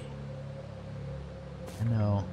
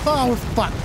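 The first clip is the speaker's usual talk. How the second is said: ordinary talk, nothing marked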